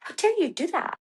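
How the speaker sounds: high-pitched affronted retort; mock indignation. Runner to husband after he yells, mock indignant. Acted offended reply to husband's yelling.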